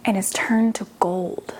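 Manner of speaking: low dramatic whisper; whispered reveal to the children; hushed whisper. Acted. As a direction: breathe it out like a secret. Dramatic story turn.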